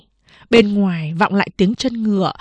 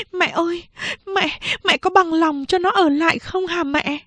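anxious plea; 9 semitones higher than usual. Acted. Daughter anxiously asks mother.

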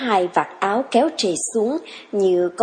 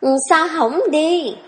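higher, impatient push; urging; 7 semitones higher than usual. A narrator's reading. Young woman hurries the farmhand along, impatient. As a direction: hurry him along. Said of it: "Thấm lại hối".

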